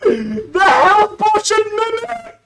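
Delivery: high, loud delighted shouting; real excited outburst; excited yell. Real, not acted. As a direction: shout it out, thrilled by the twist. Thrilled reaction to the clip.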